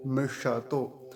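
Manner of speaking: मिषतो slowly, ष kept whole; full retroflex sibilant. Shown as a demonstration. Teacher repeats मिषतो with full ष for learners. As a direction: stretch मिषतो syllable by syllable. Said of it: "और पूरा बोलेंगे".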